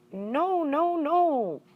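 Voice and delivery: sudden high squeaky protest; squeaked. Acted. Hamster answering the teacher, high startled squeak. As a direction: squeak it out, startled and eager to agree. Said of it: "I squeaked".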